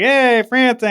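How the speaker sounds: high mock Boston greeting; lightly voiced Boston coworker; imitating coworker. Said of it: "he's like".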